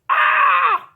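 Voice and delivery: very high, loud alarmed shriek; sudden shriek. Acted. Narrator to children, a very high loud mock shriek. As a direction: shriek as if danger just struck.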